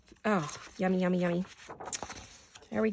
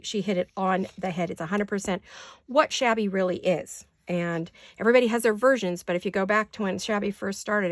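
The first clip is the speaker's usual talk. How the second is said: usual speaking voice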